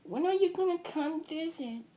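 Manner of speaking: put-on a delighted little kid, ending in a giggle; little girl voice. Cousin happily agreeing to the plan, high giggly child voice. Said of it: "she's like".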